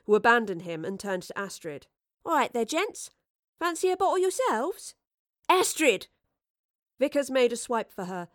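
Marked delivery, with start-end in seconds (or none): 2.3-5.0 high-pitched, cocky challenge; cheeky taunting girl. Acted. Astrid cheekily taunts the guards. 5.5-6.1 high, loud warning shout; alarmed call. Acted. Professor calls out in alarm.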